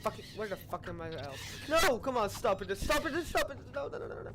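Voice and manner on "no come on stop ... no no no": high-pitched, urgent begging; raised voice, frantic repeats; panicked pleading. Real, not acted. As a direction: beg the attacker to stop in a panic. Pleading as enemies attack.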